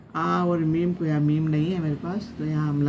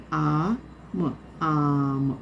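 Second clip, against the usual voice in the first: very slow sounding out; much slower than usual. Shown as a demonstration. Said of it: "मद आया".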